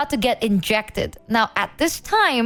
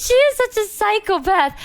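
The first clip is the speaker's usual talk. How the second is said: exasperated exclaim; 10 semitones higher, 3 dB louder than usual. Real, not acted. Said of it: "exasperated outburst".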